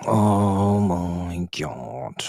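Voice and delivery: very slow, stretched-out despair; dismayed groan. Real, not acted. Fan watching his team concede, slow dismayed groan. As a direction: drag it out in disbelief.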